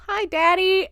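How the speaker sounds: put-on high, sweet child's greeting; cute little girl. Carol Ann greeting her relieved dad, cute and high. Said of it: "Carol Ann is so cute".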